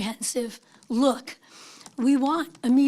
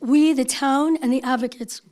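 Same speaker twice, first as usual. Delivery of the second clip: usual speaking voice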